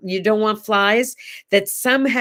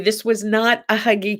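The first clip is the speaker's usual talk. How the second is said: ordinary talk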